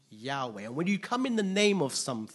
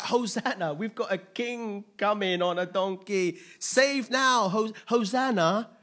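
higher, louder rallying cry, slowing into a plea; crowd crying out; 5 semitones higher, 5 dB louder than usual. Acted. Crowd hailing a king against Roman oppressors, crying out. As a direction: call out like a crowd hailing its rescuer. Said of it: "So they're like".

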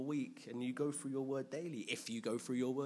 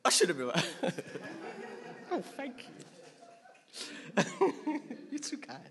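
laughing; 9 semitones higher, 3 dB louder, faster than usual. Real, not acted. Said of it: "I got excited there".

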